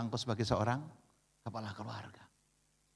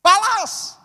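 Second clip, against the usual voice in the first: much higher, much louder shrieking yell; imitating the wife's even higher shout; shout an octave up; 14 dB louder than usual. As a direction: push it up into a furious shriek. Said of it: "Dia naik satu oktav".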